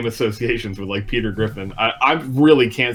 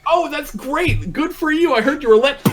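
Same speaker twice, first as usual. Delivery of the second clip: mock gushing cheer; 14 semitones higher than usual. Mocking a character cheering her on, high mock gush. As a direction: gush sarcastically like a fake cheerleader. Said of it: "He was like".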